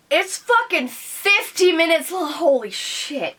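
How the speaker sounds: high, loud, slowed holy shit; stunned, dragged-out swearing; shocked disbelief. Real, not acted. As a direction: gasp it out, overwhelmed. Shocked at video length.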